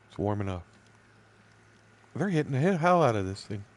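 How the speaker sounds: ordinary talk, nothing marked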